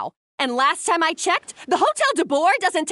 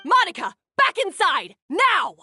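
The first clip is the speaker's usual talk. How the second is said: barked order; 2 semitones higher than usual. Acted. Head chef ordering Monica back inside, angry.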